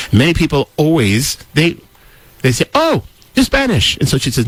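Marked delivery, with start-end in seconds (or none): 2.7-4.0 mocking quote. Mocking what people say.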